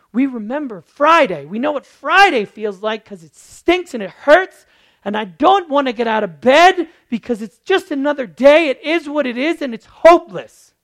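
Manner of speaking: high, loud impassioned preaching; preaching at full force. Real, not acted. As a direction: preach the pain.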